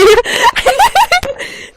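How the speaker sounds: shrieking laugh; high laugh burst. Real, not acted. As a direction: break into a high cackle. Laughs at own quote.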